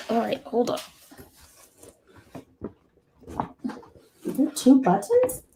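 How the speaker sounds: straining while moving a desk; strained, effortful talk; effort grunt. Real, not acted. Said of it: "Sorry for all the grunting and the noise".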